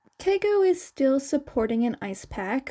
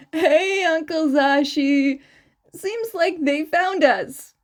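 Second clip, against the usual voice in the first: sheepish; 4 semitones higher, 5 dB louder than usual. A narrator's reading. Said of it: "Keigo said sheepishly".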